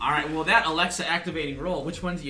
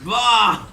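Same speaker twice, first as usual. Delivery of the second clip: loud comic splat cry; 9 semitones higher, 14 dB louder than usual. Acted. Comic reaction to street lamp hit.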